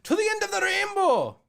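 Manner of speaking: put-on high, loud, thrilled Irish old man; excited Irish grandpa. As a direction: shout it off like a gleeful Irish grandpa. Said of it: "Where are you going".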